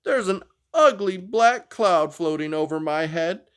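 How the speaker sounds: high, sulky complaint; a sulking dinosaur girl; gloomy Anda.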